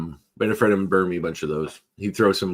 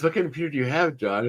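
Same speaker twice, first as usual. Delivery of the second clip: higher-pitched imitated clerk question; Gateway phone agent; 7 semitones higher than usual. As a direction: play the patient phone agent. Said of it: "She goes".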